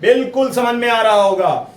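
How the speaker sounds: usual speaking voice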